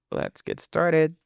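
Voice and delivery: usual speaking voice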